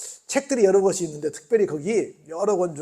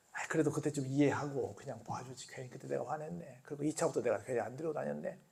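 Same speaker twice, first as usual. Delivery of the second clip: regretful; 4 semitones lower, 11 dB quieter than usual. Retold or imitated. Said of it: "근데 지금 생각해보니까".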